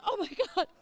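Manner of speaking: high, startled gasp of words; shocked. Retold or imitated. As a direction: realise the disaster mid-sentence. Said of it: "And I'm like".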